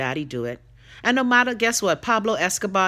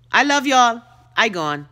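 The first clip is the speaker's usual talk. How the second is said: excited sign-off; 5 semitones higher, 5 dB louder than usual. Real, not acted. Warm sign-off to viewers.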